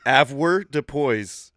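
drawn-out syllables, very slow; slow careful pronunciation. Shown as a demonstration. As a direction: say "Avoirdupois" slowly, syllable by syllable. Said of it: "at, a what?".

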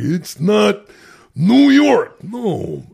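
a big ironic punch on New York; mock outrage. Shown as a demonstration. Actor hamming the joke line to his podcast friend. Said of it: "that's the humor".

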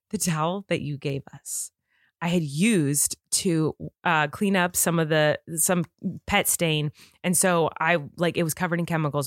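low, slow, grave imitation; really serious. Husband explains the chemical-soaked towel to wife, really serious. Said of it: "he was, like, really serious".

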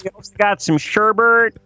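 a cheery Wisconsin neighbour listing brats and sherbet; Wisconsin accent. Retold or imitated. Neighbour inviting the listener over for brats, in a Wisconsin accent. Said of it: "Wisconsin accent".

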